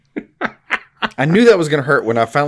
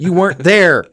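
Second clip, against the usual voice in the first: scolding; 8 semitones higher, 6 dB louder than usual. Retold or imitated. Acted boss scolding.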